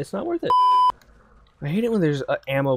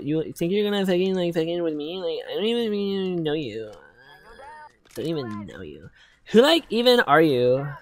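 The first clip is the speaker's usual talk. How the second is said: mock indignation; 6 semitones higher than usual. Gamer mock-confronting an imagined opponent, whiny indignation. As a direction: act out an offended tantrum, dragging the words.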